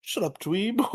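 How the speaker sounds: high, swooping mock-posh gentleman. Repeating Skull's favourite insult, posh gentleman voice. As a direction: put on a snooty posh voice. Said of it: "his posh gentleman voice".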